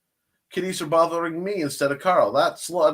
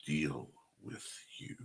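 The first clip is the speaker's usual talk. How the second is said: hushed, fading to a whisper; secretive whisper; 10 semitones lower, 8 dB quieter than usual. Retold or imitated. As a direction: lean in and whisper the threat. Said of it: "Glenn Fleming says".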